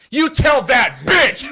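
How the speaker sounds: slow, very loud high-pitched yell; full-volume hammed-up relay of an insult; mock furious shout. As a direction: bellow each word as the irate customer. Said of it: "this is what he said".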